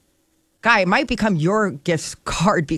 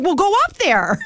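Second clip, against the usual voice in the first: friend's urging; 10 semitones higher, 3 dB louder than usual. Retold or imitated.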